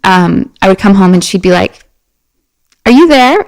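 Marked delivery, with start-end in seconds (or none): 2.9-3.4 higher-pitched questioning, lightly acted; lilting, retold question; mock questioning mom. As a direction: imitate a mom checking who is home. Mom questioning her identity, retold.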